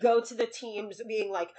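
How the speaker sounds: usual speaking voice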